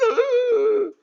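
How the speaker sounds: high, loud hammed-up fake wail; mock wailing sob. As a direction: let out a put-on crybaby wail. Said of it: "then you're gonna cry".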